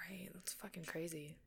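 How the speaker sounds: whispering. Real, not acted. Hushed swearing.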